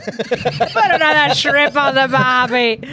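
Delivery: hammed-up Australian on the barbie line; a bad mock Australian accent. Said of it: "I don't even know how to do that accent".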